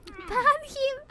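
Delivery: high squeal breaking into laughter; helpless, high-pitched laughing outburst; laughing protest. Real, not acted. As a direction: squeal it out through giggles. Joking ban demand amid laughter.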